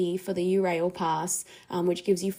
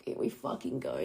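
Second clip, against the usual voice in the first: low, unvoiced mutter under the breath; muttered dread; 11 dB quieter than usual. Muttering to herself as a man approaches. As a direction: mutter it to yourself, bracing. Bracing for a stranger.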